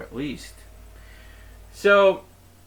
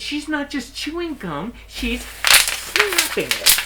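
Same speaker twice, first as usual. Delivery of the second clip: high, slow and louder on snapping it; outraged; 13 semitones higher, 5 dB louder, slower than usual. Retold or imitated. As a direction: spit out the last words in disgust. Customer ranting to manager.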